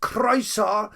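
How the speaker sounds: ordinary talk, nothing marked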